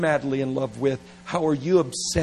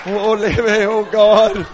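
loud, laughing, flustered fumble; flustered confession; 6 semitones higher, 10 dB louder, slower than usual. Retold or imitated. A flustered young lover at last confessing, loud and laughing. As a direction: trip over the words in a giggly panic. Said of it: "They're like".

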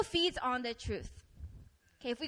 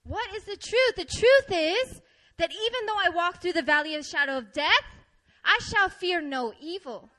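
loud, raised-pitch scripture declaration; forceful preaching; 4 semitones higher, 7 dB louder than usual. Real, not acted. Preacher proclaiming truth from the psalm, forceful preaching. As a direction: proclaim each phrase with rising conviction.

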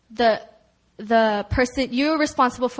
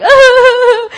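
loud, high imitated sob; hammed-up wail of someone bawling; comic high-pitched crying noise; mock bawling wail; 12 semitones higher, 15 dB louder than usual. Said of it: "started weeping and bawling and crying".

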